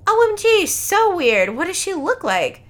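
loud, high gushing reaction; over-the-top amazed outburst; excited shock. Acted. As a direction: exclaim it, hammed-up teen drama. Acting Trisha's shocked excitement.

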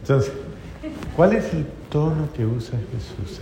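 ordinary talk, nothing marked

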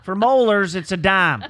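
loud, high hammed-up country accent; put-on accent. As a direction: lay the country accent on thick. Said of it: "The accent".

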